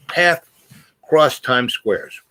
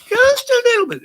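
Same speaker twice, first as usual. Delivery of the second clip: very high, louder squeak; tiny little voice; 5 dB louder than usual. Acted. Speaker playfully insisting on only a little, tiny squeaky voice. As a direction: squeeze it up into falsetto. Downplays how stoned he likes getting.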